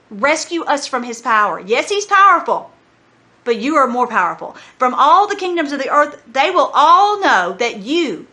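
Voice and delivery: impassioned plea to the Lord, phrases rising and falling; fervent preaching prayer; preached prayer. Acted. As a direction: pray it out to a congregation with emphatic key words.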